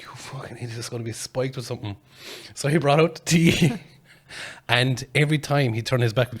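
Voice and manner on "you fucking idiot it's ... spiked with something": retold warning under the breath; hushed aside. Man warning his friend about the tea, hushed aside. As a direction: keep it from the host, much softer.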